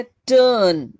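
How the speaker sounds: टर्न slow and loud, r silent; slow stressed टर्न; long er vowel. Shown as a demonstration. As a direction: draw out टर्न as tuhn. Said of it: "अ की आवाज़".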